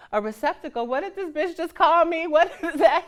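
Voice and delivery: rising to a high, loud, offended outburst; acted outrage, building in pitch and volume; indignant disbelief. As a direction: react as if freshly insulted. Acted shock at being called receptacle.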